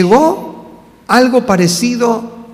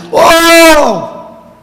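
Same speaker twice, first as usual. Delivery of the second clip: loud, high, drawn-out cry; acted shout; 9 semitones higher, 6 dB louder than usual. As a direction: bellow it like a desperate appeal. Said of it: "Entonces grita". (grito actuado, fuerte y alargado)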